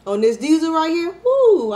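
loud, high caffeine-fuelled whoop; hyped up. Real, not acted. Vlogger buzzing on strong coffee, loud excited whoop. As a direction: ride the coffee buzz into a whoop. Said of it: "I talk fast normally".

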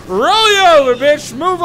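high, loud gloating yell; triumphant taunt. Pretend. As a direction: crow over the kill. Gloating after running over monster.

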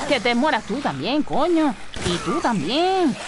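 venting rage at the monsters; angry yelling. Real, not acted. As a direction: yell at them as you fire. Shooting enemies, venting.

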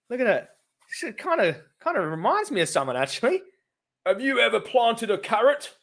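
mock Gareth with the pot plant; sarcastic show-and-tell for Gareth; sarcastic mockery. Real, not acted.

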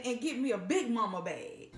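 ordinary delivery

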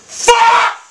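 sudden high furious yell; angry shout. Real, not acted. Gamer yelling at his bad golf shot, furious. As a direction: explode at the missed shot.

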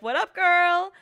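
high, loud, bubbly hello to a friend; chirpy greeting. Retold or imitated. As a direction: sound cheerfully clueless. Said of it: "snap her back and be like".

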